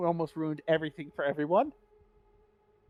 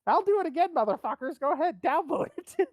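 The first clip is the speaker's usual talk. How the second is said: defiant taunting; 10 semitones higher than usual. Real, not acted. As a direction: dare them to downvote.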